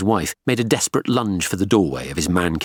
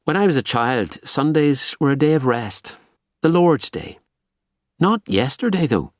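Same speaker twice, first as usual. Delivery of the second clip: higher-pitched Belfast woman's voice; Belfast-accented detective, pitched up; 8 semitones higher than usual. A narrator's reading. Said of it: "In her Belfast accent".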